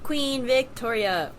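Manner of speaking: ordinary talk, nothing marked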